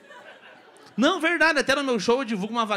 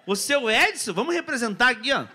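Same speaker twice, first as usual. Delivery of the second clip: usual speaking voice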